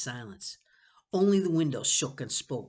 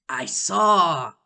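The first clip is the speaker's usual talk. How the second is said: ordinary delivery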